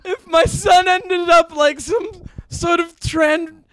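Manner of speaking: high, loud mock-accented wailing mom; melodramatic mother. Mock mom despairs at son's news, melodramatic high shouting.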